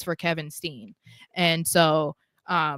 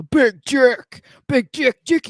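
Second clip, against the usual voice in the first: announcer hype; 5 semitones higher, 8 dB louder, slower than usual. Pretend. Hyping a wrestler entrance name.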